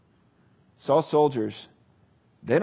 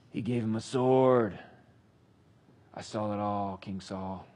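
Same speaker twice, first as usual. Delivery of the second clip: low, slow, sneaky voice; sly informer; 5 semitones lower, 4 dB quieter than usual. Acted. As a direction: tell on the priest like a creepy informer. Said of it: "a voice breaks through ... spoke up".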